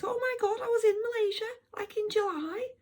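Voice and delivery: very high, loud mock-Scouse; Scouse accent. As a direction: imitate an excited Liverpool lady in a high Scouse voice. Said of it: "Anyone that's a Scouser listening, sorry about that accent".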